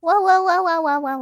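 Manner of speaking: high, loud repeated waouh calls; loud playful howl. Acted. Streamer howling loudly to open the stream.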